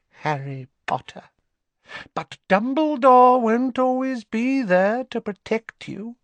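a haughty lady warning; menacing Narcissa. Acted. Narcissa coldly warning Harry Potter.

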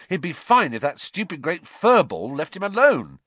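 loud, high, indignant complaint; angry blame. Acted. Boy blaming the cat for his sickly rat, indignant. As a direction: complain loudly and bitterly.

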